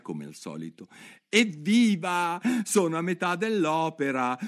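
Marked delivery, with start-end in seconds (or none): 1.3-4.4 Alice delighted with herself; cheering. Acted. (Alice esulta tra sé)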